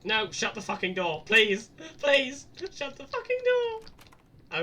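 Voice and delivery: panicked pleading, high and loud; terrified begging shout; scared horror game streamer. Real, not acted.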